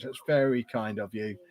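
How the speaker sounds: usual speaking voice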